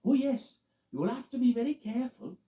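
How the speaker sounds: loud, high urgent warning. Acted. Loretta warning Eddie about icy roads, urgent. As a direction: caution him earnestly. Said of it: "warned Loretta".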